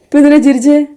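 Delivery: high, loud mock interrogation; playful scolding. Real, not acted. Adult asking a toddler why the noise and laughing, loud scolding.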